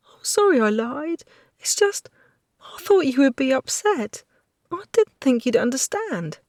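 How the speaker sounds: a hint of a frightened maid begging; lightly voiced tearful maid. Hinkle voice pleading.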